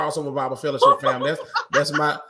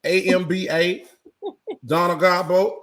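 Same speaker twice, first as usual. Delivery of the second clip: normal talking voice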